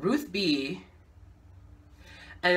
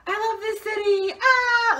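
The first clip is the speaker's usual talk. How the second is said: high, loud stage shout to the city; mock singer to crowd; 5 dB louder, slower than usual. Singer greeting the crowd from stage, high hammed-up shout. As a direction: ham up a pop star greeting the crowd.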